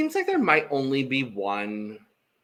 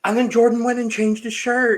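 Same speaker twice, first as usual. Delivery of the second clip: high, raised-voice delighted exclamation; gleeful exclamation; 8 semitones higher, 4 dB louder than usual. Real, not acted. Co-host recalling the favorite part, high gleeful exclamation. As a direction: blurt it out high with glee.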